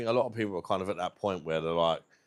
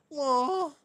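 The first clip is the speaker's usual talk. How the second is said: squeaky what; 4 dB louder than usual. Shown as a demonstration. Squeaky mock word.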